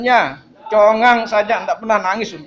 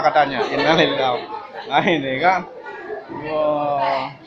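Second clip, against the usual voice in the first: normal talking voice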